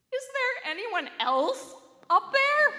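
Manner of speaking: weak, terrified voice. A narrator's reading. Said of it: "in a weak, terrified voice".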